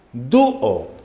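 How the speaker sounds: "Dúo" in two syllables, dú-o; hiatus shown. Shown as a demonstration. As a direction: split "Dúo" between ú and o. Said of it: "tenemos hiato de qué tipo".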